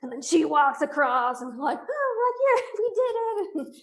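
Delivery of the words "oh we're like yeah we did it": very high-pitched mock celebration; retold whoop with a laugh in it; relieved cheer. Hikers celebrating getting across past the snake, retold relieved cheer.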